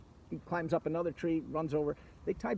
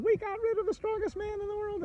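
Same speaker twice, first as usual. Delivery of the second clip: very high, far-off gleeful voice; the dragon and his mother celebrate over Slivovitz; gloating dragons. Acted. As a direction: crow it out in triumph.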